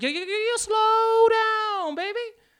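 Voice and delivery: very high, drawn-out mock plea; hammed-up falsetto protest; protesting partner. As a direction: beg them to ease off in falsetto. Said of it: "the person on the other side is like".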